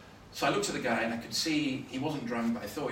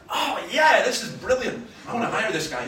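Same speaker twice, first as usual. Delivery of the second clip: loud, high burst of glee; exultant retold reaction, starting at a peak; delighted; 9 semitones higher, 10 dB louder than usual. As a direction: cheer it on with relish. Acts out his delight at heckler.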